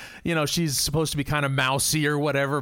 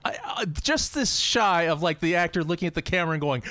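ordinary talk, nothing marked

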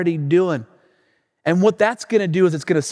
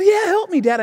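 put-on pleading young son; 13 semitones higher than usual. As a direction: call out for help like a kid. Son begging dad for help.

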